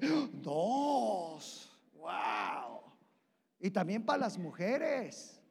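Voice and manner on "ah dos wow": delighted men. Pretend. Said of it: "Y los hombres dicen".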